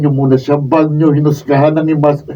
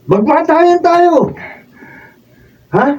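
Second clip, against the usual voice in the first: defiant challenge; 13 semitones higher, slower than usual. Acted. Challenging rival to fight.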